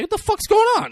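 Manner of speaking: high-pitched mock alarm; alarmed retelling. Retelling a film character walking in, high mock alarm. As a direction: demand answers in a high acted voice.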